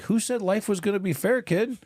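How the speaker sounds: high-pitched parental comeback; mock-indignant retort. Dad answering son's fairness complaint, mock-indignant retort. Father retells snapping back at son.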